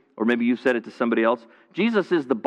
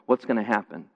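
ordinary delivery

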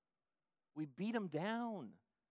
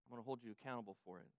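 quiet warning; 5 semitones lower, 10 dB quieter, much faster than usual. Shown as a demonstration. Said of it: "If you have this".

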